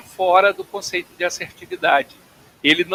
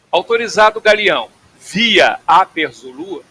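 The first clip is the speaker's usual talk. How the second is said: clear, deliberate controller phrasing; radio clearance read; 3 semitones higher, 6 dB louder than usual. Shown as a demonstration. Instructor demonstrating a clearance to students, deliberate radio voice. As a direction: spell out the clearance for the pilot. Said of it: "ele seria algo desse tipo aqui".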